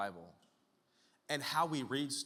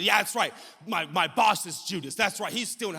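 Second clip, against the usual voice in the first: eager, louder amen-style agreement; self-righteous agreement; 4 semitones higher, 9 dB louder than usual. Acted. Churchgoer agreeing that the boss is Judas, self-righteous. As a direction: pipe up as the smugly agreeing churchgoer.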